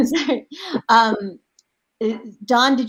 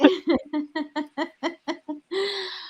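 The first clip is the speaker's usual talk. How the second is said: laughing; 11 semitones higher than usual. Real, not acted. Keeps laughing after Katja's remark.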